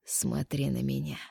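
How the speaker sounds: low, slow, soft command. A narrator's reading. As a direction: order her quietly and slowly. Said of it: "приказал".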